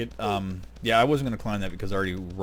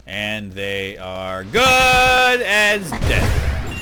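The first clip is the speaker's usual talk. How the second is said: louder, very slow dramatic threat; mock menace; 7 dB louder, much slower than usual. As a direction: stretch each word like a villain's promise. Vowing to wreck bad wizards.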